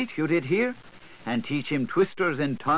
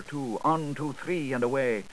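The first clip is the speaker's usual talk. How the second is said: usual speaking voice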